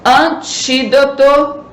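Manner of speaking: "Antídoto" bem devagar e agudo, com TÍ marcado; sílaba tônica destacada. Shown as a demonstration. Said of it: "você vai acentuar o i". ("Antídoto" em ditado lento, alongando o i tônico)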